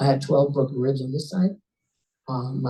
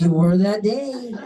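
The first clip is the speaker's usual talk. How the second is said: slow, louder, higher punchline; teasing comeback; 4 semitones higher, 5 dB louder, slower than usual. Retold or imitated. Rescuer answering victim's protest about her speech, teasingly emphatic. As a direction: drag out the retort for a laugh.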